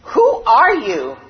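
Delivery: loud, slow calling out; boy calling loudly into the forest; curious shout. A narrator's reading. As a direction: call out into the mountains. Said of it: "he began to scream".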